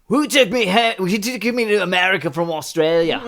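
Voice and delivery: high, loud hammed-up Aussie accent; confused Australian. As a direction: wake up bewildered and suddenly Australian. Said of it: "you think you're Australian".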